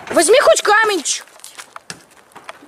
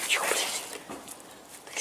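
urgent hushed whisper; whispering while hiding; 19 dB quieter, much slower than usual. Real, not acted. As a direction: breathe it, barely voiced. Hiding, hushing partner. (шёпот в укрытии)

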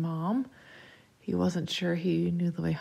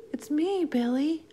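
put-on high child voice, luring; high-pitched ghost child; eerie child's voice; 8 semitones higher than usual. A narrator's reading. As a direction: speak up high like a little friend. Lost child's ghostly voice answers.

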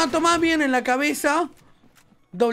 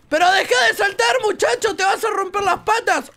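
shouting; 7 semitones higher than usual. Real, not acted. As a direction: yell at him to stop jumping. Scolding teammate who keeps jumping.

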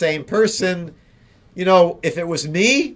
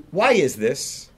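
ordinary talk

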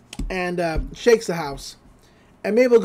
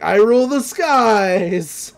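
slow, triumphant bellow; gruff Grinda; 4 dB louder, much slower than usual. Retold or imitated. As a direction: stretch it out like a conqueror. Grinda voiced in recap.

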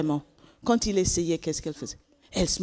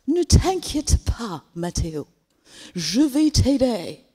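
reassuring; 5 semitones higher, 4 dB louder, slower than usual. Acted. Kind girl reassures the boy.

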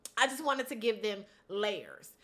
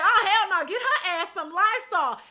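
loud, higher shocked outburst; 8 semitones higher, 8 dB louder than usual. Retold or imitated. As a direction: blurt it out, loud and appalled. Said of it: "And I said".

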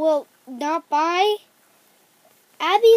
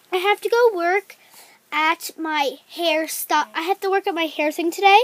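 ordinary talk

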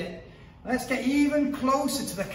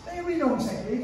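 usual speaking voice

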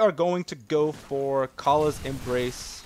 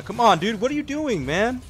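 exasperated; 10 semitones higher, 5 dB louder than usual. Real, not acted. Teammate making bad play.

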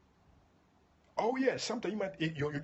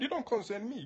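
mock dismissive voice; 9 semitones higher than usual. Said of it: "he said".

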